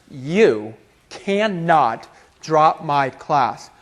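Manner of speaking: very slow and low, word by word; stern, deliberate refusal. Retold or imitated. Professor forbids student to drop her class, deliberate.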